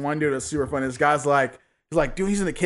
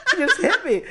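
shocked; 9 semitones higher than usual. Retold or imitated. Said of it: "standing there shocked".

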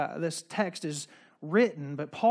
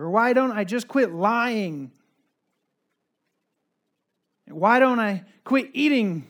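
louder, higher, slowed pleading sermon lines; fervent preaching; 5 semitones higher, 6 dB louder, slower than usual. Acted. Pastor pleading with the congregation, fervent and slow. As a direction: press each question home to the congregation.

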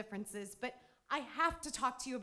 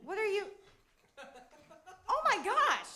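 shocked outrage; 3 semitones higher, 9 dB louder than usual. Acted. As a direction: gasp it out in disbelief. Neighbor discovers stolen furniture.

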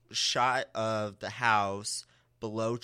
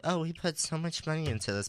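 higher, quicker quoted complaint; imitating the homeowners; 7 semitones higher, faster than usual. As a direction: voice the couple's excuse, pitched up. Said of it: "because they're like".

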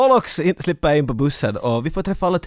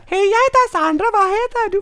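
put-on childlike voice. Host mocking Sandra introducing herself to the driver, childlike. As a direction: greet him like a five-year-old. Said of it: "Var hon fem?".

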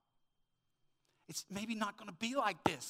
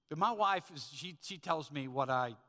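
usual speaking voice

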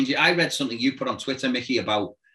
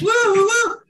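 high wailing siren woo, louder; siren impression; 6 dB louder than usual. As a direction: imitate the siren again, up high. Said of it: "and he's doing this".

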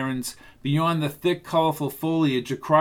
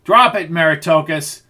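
loud barked command; drill sergeant; 2 semitones higher, 8 dB louder than usual. A narrator's reading. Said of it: "Jansen sounded like a drill sergeant".

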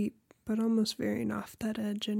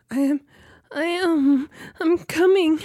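high, loud, rising cries; climactic cries; 7 semitones higher, 11 dB louder than usual. Acted. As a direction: let it build into loud cries. Character climaxing.

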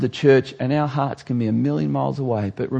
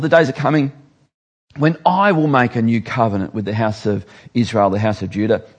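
normal talking voice

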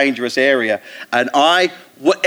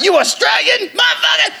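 hammed-up delighted holler; mock excited shout. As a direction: yell it like a thrilled fan in the drive-through. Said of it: "they're all like".

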